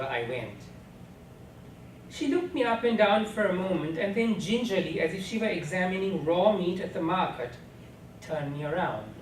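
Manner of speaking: ordinary delivery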